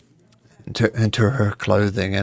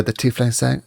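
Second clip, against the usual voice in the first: ordinary delivery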